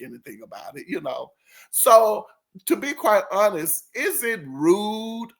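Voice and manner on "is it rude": slow question. Real, not acted.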